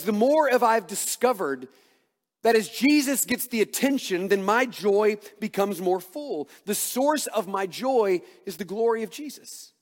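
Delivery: loud, driving preacher voice; full-force preaching. Acted. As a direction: preach it out to the congregation.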